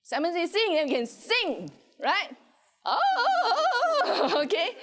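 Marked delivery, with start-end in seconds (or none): 2.9-4.8 laughing. Real, not acted. Laughs at a student's answer.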